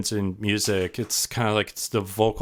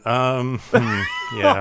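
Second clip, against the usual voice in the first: awkward hesitation; 4 dB louder, slower than usual. Retold or imitated. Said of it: "everyone in the whole room's just kinda going".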